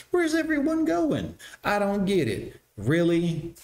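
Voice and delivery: mock clueless woman. Host mocking the caller's confusion, high baffled mock voice. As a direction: play dumb, baffled and wide-eyed.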